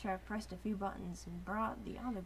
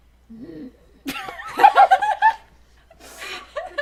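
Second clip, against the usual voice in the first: very high roaring laugh; loud laugh; 19 dB louder than usual. Real, not acted. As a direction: burst out laughing. Huge laugh.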